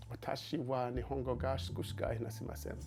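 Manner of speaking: soft, careful Japanese "Watashi wa nihongo ga sukushika hinasimasen"; 日本語をゆっくり、小さな声で; Japanese phrase. Shown as a demonstration. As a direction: recite it like a half-remembered lesson. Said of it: "You know".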